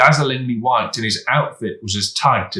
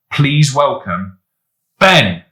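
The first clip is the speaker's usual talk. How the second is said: announcer; 4 semitones higher, 6 dB louder, slower than usual. A narrator's reading. Host announcing the next act.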